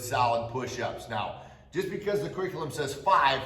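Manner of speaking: usual speaking voice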